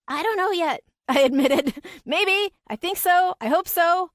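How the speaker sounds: high, laughing, hopeful voice; nervous laugh. A narrator's reading. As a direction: laugh it out, unsure but hopeful.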